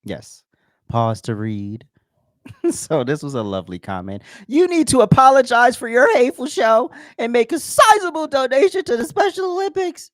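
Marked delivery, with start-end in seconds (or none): 4.5-10.1 much higher and louder, whiny mock reading. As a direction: read it like a shrill outraged critic. Reading a hateful listener comment.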